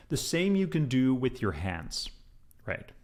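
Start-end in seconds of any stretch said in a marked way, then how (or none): none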